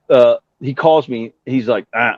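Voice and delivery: usual speaking voice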